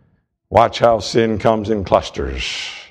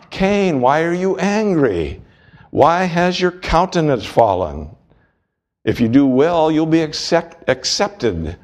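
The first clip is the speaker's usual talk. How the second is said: higher, gently pleading voice of God; 6 semitones higher than usual. Acted. God pleading with angry Cain, higher and gentle. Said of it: "God is pleading with him".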